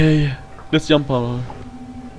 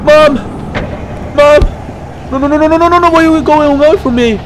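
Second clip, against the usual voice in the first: panicked calling for mom; desperate plea; 5 semitones higher than usual. Real, not acted. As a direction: beg mom to stay. Mother leaving her.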